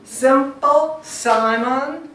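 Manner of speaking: Simple, Simon with loud first syllables; trochee stress. Shown as a demonstration. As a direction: punch SIM in Simple and SI in Simon.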